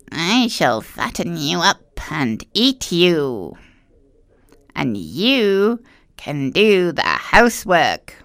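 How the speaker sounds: put-on slow, gloating old witch. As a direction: savour every word like a greedy witch. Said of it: "she told Gretel".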